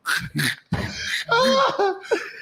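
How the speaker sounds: high burst of laughter. Real, not acted. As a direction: crack up laughing.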